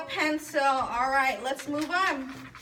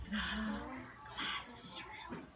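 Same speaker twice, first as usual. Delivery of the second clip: whisper reading; 2 semitones lower, 11 dB quieter, slower than usual. Shown as a demonstration. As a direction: model a quiet classroom whisper. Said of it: "This is how you should sound".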